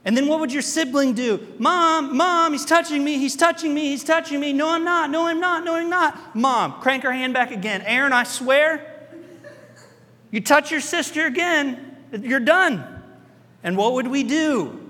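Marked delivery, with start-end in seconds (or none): 1.6-4.5 high, loud whiny tattle; whiny tattling little sibling. Retold or imitated. 4.5-6.4 put-on high, loud childish denial; whiny kid denial. Kid denying to mom. 7.8-12.8 slow, loud stern mom threat; stern mom warning. Retold or imitated. Mom threatening from front seat.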